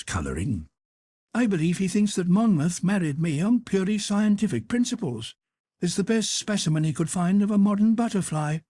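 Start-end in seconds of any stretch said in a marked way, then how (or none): 1.3-8.6 arch, teasing noblewoman, pitched up; high Duchess voice. Acted. Duchess challenging Lord Henry, high and teasing. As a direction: play the witty Duchess, high and bright.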